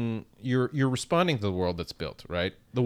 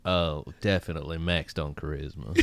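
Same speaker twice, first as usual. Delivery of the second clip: McConaughey voice; 4 semitones lower, 4 dB quieter than usual. Retold or imitated. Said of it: "Matthew McConaughey".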